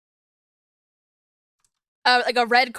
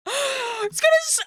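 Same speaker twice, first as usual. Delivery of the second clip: excited Mordecai quote; 6 semitones higher than usual. Retold or imitated. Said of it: "Mordecai says".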